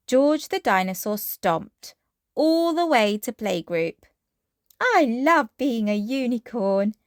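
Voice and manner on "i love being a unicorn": usual speaking voice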